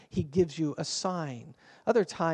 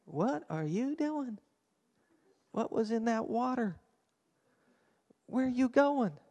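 puzzled passenger; 5 semitones higher, 4 dB quieter than usual. Acted. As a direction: ask as if baffled by a companion's outburst. Imagined passenger reacting to random outburst.

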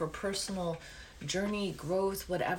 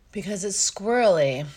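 louder, playful outburst; loud exclamation; 2 semitones higher, 11 dB louder than usual. Real, not acted. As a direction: blurt it out loud. Loud exclaim about computer.

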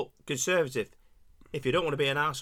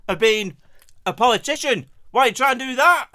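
angry rant; 5 semitones higher, 6 dB louder, faster than usual. Acted. Mock-rant at the politician.

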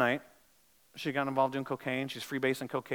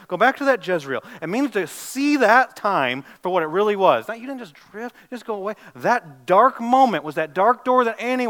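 raised, pressing sermon voice; urgent preaching; 5 semitones higher, 10 dB louder than usual. Acted. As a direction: push the congregation to repent. Preaching, challenging the listeners.

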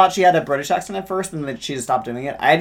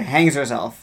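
ordinary delivery

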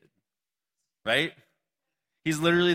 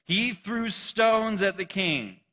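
scripture reading; 6 semitones higher, 4 dB louder, much slower than usual. Acted.

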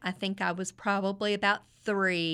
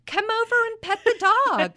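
giving-in mom; 8 dB louder than usual. Retold or imitated. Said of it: "She was like".

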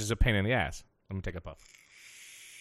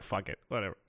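resigned mutter; 7 semitones lower, 12 dB quieter than usual. Retold or imitated. Giving up in the line.

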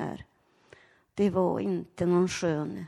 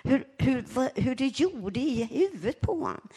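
ordinary delivery

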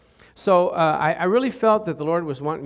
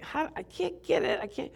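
frustrated hobbyist; 11 semitones higher, 9 dB quieter than usual. Retold or imitated. Frustrated model builder voiced.

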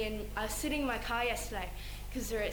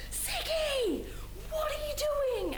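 put-on much higher, whiny kid-brother voice; imitating little brothers; slower than usual. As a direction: squeak it like a pestering little brother. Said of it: "my brothers were just like".

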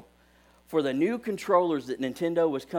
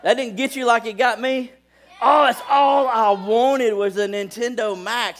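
excited; 8 semitones higher, 7 dB louder than usual. Real, not acted. Gushing over childhood game controller.

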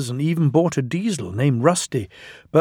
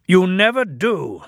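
higher, mildly scolding retort; stern voice; 7 semitones higher, 4 dB louder than usual. A narrator's reading. Controller rebukes Sir Handel for not thinking, sternly. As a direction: cut in with stern reproof. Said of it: "said a stern voice".

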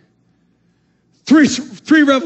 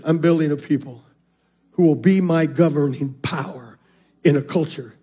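hushed emphasis; 9 semitones lower, 6 dB quieter than usual. Real, not acted. Preacher drops voice for emphasis.

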